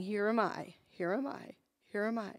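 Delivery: under the breath, steadying herself; quiet prayer. Retold or imitated. Mother praying quietly to calm herself in the car, murmured prayer. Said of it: "I just keep saying".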